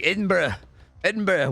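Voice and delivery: a half-hearted, badly faked Scottish accent on "Edinburgh". Streamer mocking his own attempt at a Scottish Edinburgh, badly faked Scottish accent. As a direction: try "Edinburgh" the way a Scottish person might, and fail. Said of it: "which is a terrible, I can't do Scottish accents".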